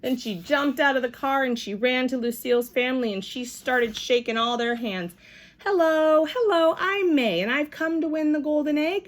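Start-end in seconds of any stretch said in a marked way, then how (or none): 5.6-9.1 high, bouncy hello; eager girl bursting to win, voiced high; excited greeting. A narrator's reading. May greeting Lucille's family, high and eager.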